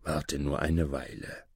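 low, soft reassurance; tröstend. A narrator's reading. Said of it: "tröstete ihn der Fremde". (leise, tief und tröstend)

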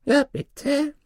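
very high, obliging dwarf voice. Acted. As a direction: squeak it as a questioning dwarf. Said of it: "sagte der Zwerg". (sehr hohe Zwergenstimme, fragend)

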